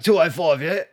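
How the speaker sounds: light imitation of the tyre guy; mock dodgy bloke. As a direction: ask the tyre size like the garbled seller. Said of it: "couldn't actually speak properly".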